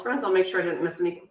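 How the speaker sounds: ordinary talk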